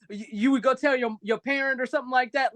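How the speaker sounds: ordinary talk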